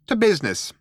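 high little mouse voice opening a meeting; businesslike mouse. A narrator's reading. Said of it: "said Benji Mouse".

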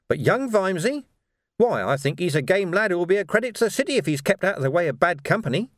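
taunting gang leader Carcer. Acted. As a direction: needle the rebel while giving orders.

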